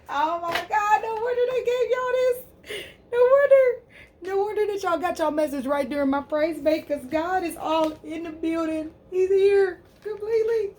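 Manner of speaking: very high sung-out ecstatic praise. Real, not acted. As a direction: sing out your amazement at God. Ecstatic reaction to cards.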